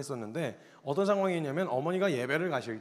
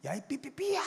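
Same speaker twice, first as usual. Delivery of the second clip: bleeped-out insult pitched way up; bleeped swear; 10 semitones higher, 4 dB quieter than usual. Retold or imitated. Boy cursing at his older sister, bleeped and high. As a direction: yelp the censored curse high. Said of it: "쌍욕을 한 거예요".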